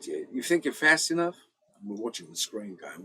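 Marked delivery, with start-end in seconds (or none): 0.0-1.4 higher, louder mock American challenge; imitating Americans. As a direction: toss out a cocky dare like an American. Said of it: "the Americans going".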